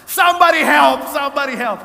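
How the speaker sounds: loud, high desperate shout, easing slightly; cry for help. Retold or imitated. Injured woman begging anyone nearby, crying out. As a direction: yell for rescue, imitating someone hurt.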